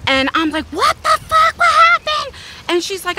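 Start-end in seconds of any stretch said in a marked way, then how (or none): 0.7-2.3 high, loud shocked demand; alarmed outburst. Retold or imitated. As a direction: re-enact a mother's outraged shock, voice shooting up. Reacts to daughter's injured face.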